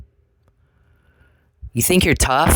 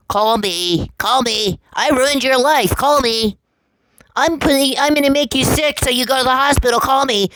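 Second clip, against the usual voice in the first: much higher, whiny sneering mimicry; mocking Charles Perry; 13 semitones higher than usual. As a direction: squeak out his pleading like a taunt. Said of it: "one of your leaks is Charles Perry himself".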